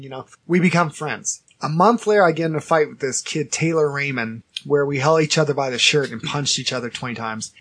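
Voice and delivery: ordinary delivery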